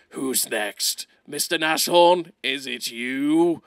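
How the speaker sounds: low, slow mock French voice; mock French accent. The gamer voicing his hungry KV-2 hunting the Nashorn, mock French accent. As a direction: play a hungry tank in a hammed-up French accent. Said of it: "he turned French for a minute there".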